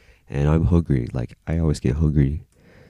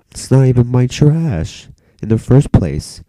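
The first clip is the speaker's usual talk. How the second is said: high, loud, drawn-out retold objection; indignant protest; 8 semitones higher, 5 dB louder, slower than usual. Student protesting to the substitute about trash, indignant. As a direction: protest it slowly, pitched way up, imitating outrage.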